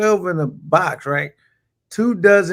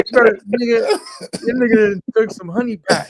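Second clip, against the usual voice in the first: laughing; 6 semitones higher than usual. Real, not acted. Laughing while teasing Ben.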